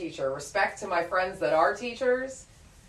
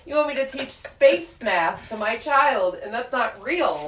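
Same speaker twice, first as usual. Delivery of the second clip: louder, higher frustrated outburst; exasperated rant; 7 semitones higher, 7 dB louder than usual. Retold or imitated. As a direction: throw up your hands, exasperated. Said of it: "I'm like".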